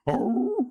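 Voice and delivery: rising "aroo" dog howl; mimicked doggy howling; imitated dog howl. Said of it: "doing these really deep".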